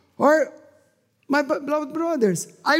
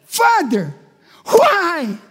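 louder anguished plea, voice breaking; crying out; 6 semitones higher, 7 dB louder, much slower than usual. Retold or imitated. Preacher retelling his anguished prayer, crying plea. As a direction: cry out to God in despair. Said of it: "I said".

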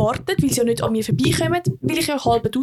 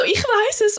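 hoher, verzweifelter Ausruf; fast brüllend; 9 semitones higher than usual. Retold or imitated. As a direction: halb gebrüllt, viel höher. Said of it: "Und fast am Brüllen". («Ich weiss es» fast gebrüllt, höher)

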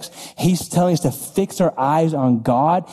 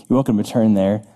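ordinary delivery

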